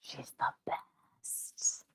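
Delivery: slow soft whisper; whispered adoration. Real, not acted. Owner whispering praise over her sleeping cat. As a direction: breathe it out lovingly.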